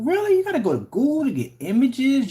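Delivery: sarcastic high squeak of disbelief; mock disbelief. Real, not acted. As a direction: act shocked, mocking him. Mocking the rival's Google images.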